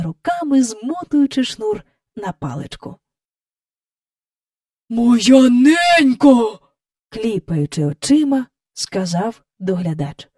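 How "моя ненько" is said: loud, slow, drawn-out astonishment; amazed exclamation. A narrator's reading. Park keeper blinking at the flying figure, amazed exclamation. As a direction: stretch it out in stunned wonder.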